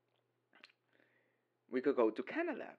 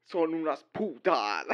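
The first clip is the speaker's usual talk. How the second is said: louder, slow mock-clumsy Spanish accent; bad Spanish imitation; 6 semitones higher, 7 dB louder than usual. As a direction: imitate badly spoken Spanish through laughter. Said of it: "don't speak Spanish well because it's go like".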